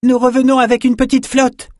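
loud, very high sustained shout; high-pitched hailing yell; calling out. A narrator's reading. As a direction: holler the news across to another boat. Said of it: "cria Peretta".

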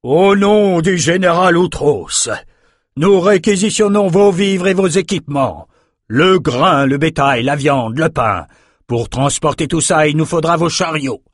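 commandant qui tonne, plus aigu et plus fort; voix de stentor. A narrator's reading. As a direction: proclame l'ordre à toute la ville, lentement au début. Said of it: "Enoch parla d'une voix de stentor". (Enoch réquisitionne tout devant les citadins, d'une voix de stentor)

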